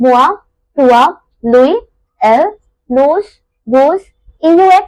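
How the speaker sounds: each pronoun said separately and punched; reciting a list. Shown as a demonstration. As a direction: tick off each pronoun one by one. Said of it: "pronoun tonic हम याद कर लेते हैं".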